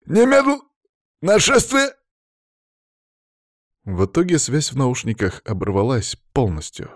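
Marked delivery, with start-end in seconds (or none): 0.1-1.9 high, loud roar cutting out; urgent furious shouting over a failing line. A narrator's reading. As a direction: bellow in broken-off bursts. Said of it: "рычал Палыч, постоянно прерываясь".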